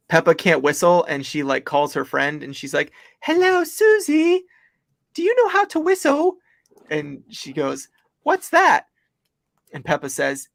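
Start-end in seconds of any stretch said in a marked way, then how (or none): 3.2-6.4 Peppa Pig voice. Retold or imitated. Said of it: "It was a horrible impersonation of the episode". 8.2-8.9 put-on high squeaky kid's question; cartoon friend voice. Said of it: "horrible impersonation of the episode".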